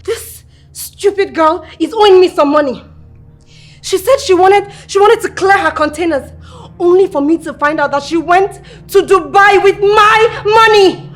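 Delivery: loud, high, outraged phone rant; furious shouting. Acted. Woman shouting at the debtor over the phone, furious. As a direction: blast her down the line. Explaining the theft, still raging.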